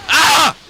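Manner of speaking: shrill, loud mock death yell; stupid death noise. Shown as a demonstration. Demonstrating the dying soldiers' noise, loud high mock yell. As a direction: show off the silly noise soldiers make when they die. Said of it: "Like that".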